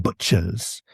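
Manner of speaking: butchers slowed, the ch cluster dwelt on; drawn-out butchers. Shown as a demonstration. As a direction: savor the ugly middle of butchers. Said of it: "this really, ugly cluster".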